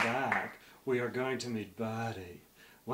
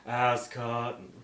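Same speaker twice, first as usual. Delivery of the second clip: hard sell ad; 4 dB louder than usual. Acted. Infomercial pitch for app.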